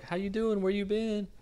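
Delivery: high-pitched mock female greeting; imitated girl. Girl greeting [name] on the street, high mock hello.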